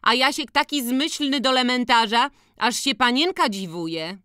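high-pitched proud village mother; boasting mother. A narrator's reading. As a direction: brag about your boy in a high voice. Matka chwali syna. (wysoki głos dumnej matki)

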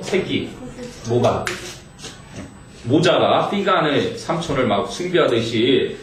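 usual speaking voice

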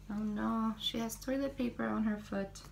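ordinary delivery

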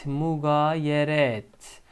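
slow drawn-out "Mugayeret"; syllable by syllable; slow model reading. Shown as a demonstration. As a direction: say it slowly for students. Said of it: "Kelimemiz mugayeret".

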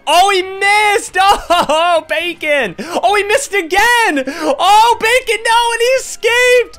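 excited shout. Real, not acted. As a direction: yell at the screen as the last teammate fights on. Watching teammate fight in game.

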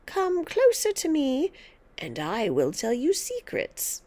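put-on high, sly witchy old-woman voice; sinister grandma. Grandma luring George closer, in a sly witchy voice. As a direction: coax the boy in like a witch.

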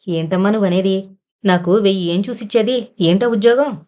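ordinary delivery

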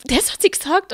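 retold shock at what a mother said; stunned disbelief. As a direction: ask it as if you can't believe your ears. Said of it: "Und ich so".

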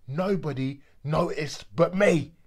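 slow, heavy emphasis on "me"; loud and slow. Acted. Repeated line, built up.

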